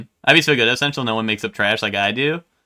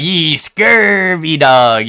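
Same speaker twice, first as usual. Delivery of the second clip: slow pirate drawl; 4 semitones higher, 6 dB louder, much slower than usual. Retold or imitated. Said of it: "You're like".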